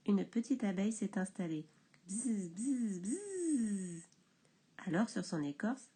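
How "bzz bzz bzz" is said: 1 bourdonnement imité. A narrator's reading.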